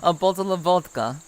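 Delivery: playful put-on Russian accent. Streamer joking with chat about vodka, Russian accent. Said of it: "we'll say it is Russian accent".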